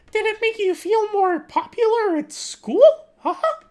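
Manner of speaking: loud, slow, very high falsetto; Mickey Mouse mom. Acted. Mom questions Roderick about school, loud Mickey Mouse falsetto. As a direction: press him in a squeaky, drawn-out mom voice. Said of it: "she's Mickey Mouse".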